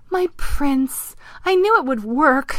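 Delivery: high, gushing princess; exclaiming princess. Acted. The princess greeting her newly transformed prince, exclaiming. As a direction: squeal of triumph, very high. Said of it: "she exclaimed".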